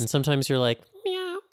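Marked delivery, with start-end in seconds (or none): 1.0-1.4 high, squeaky meow; cat meow. Pretend. Imitating a cat.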